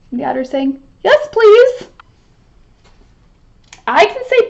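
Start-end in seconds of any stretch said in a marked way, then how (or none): 1.0-1.9 much higher and louder, bright eagerness; eager yes. Acted. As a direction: jump at the offer. Otter eagerly answers the bee.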